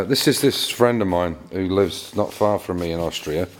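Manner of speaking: ordinary talk, nothing marked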